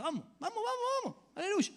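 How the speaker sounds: high, rising vamos, vamos; excited urging. Real, not acted. Pastor urging the congregation to raise hands, excited. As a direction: egg the crowd on, climbing.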